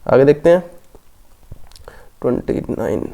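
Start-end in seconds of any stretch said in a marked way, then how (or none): 2.2-3.1 soft whisper to yourself; whispered aside. Real, not acted. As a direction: whisper the number under your breath. Muttering the question number aside.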